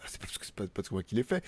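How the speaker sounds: secretive whispered aside. Real, not acted. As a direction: whisper it like a confession. Confiding aside about the article.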